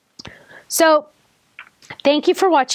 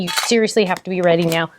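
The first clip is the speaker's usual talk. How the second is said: usual speaking voice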